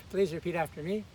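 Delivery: ordinary talk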